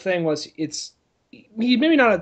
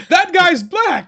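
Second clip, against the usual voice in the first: mock Hammond pointing out; 12 semitones higher, 7 dB louder, slower than usual.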